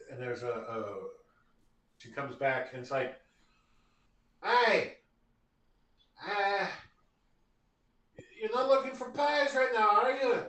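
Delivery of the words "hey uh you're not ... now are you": put-on higher, louder chirpy shop girl; high, loud halfling baker greeting; halfling shopkeeper. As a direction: call out across the shop like a busy halfling.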